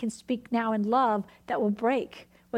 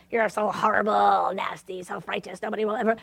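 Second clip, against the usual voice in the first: a hint of a sneering devil, lower; mean devil voice; 4 semitones lower than usual. Preacher voicing the devil accusing a believer, lightly mean and lower. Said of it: "it's in a mean way".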